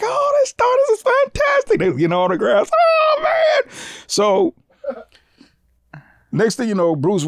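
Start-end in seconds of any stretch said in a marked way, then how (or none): 0.0-1.8 very high, squealing fan voice; imitating gushing fans. Fans left outside greet whoever comes out, gushing. 2.7-3.7 high, drawn-out fan squeal; imitating thrilled fan. Fan getting autographs from the stars, thrilled.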